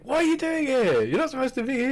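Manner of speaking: high squeaky mock outrage; mock outraged Logan. Imitating Logan Paul confronting the intruder, high mock outrage. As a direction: play him shrieking at the intruder. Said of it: "And he's like".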